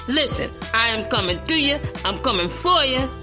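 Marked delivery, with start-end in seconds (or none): none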